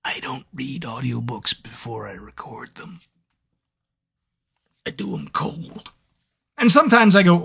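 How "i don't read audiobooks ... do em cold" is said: whispered confession, much softer; secret whisper. Shown as a demonstration. Narrator letting fans in on a secret, whispering. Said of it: "I'll let you in on a little secret".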